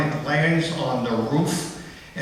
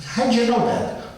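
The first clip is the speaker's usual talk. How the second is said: high, slow mock mother; mother quizzing her son, voiced high; 9 semitones higher than usual. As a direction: play the knowing mother up high.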